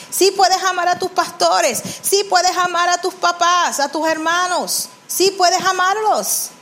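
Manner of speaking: high-pitched, insistent preacher's declarations; impassioned preaching. Real, not acted. Preaches with raised voice. (predicación enfática en tono agudo)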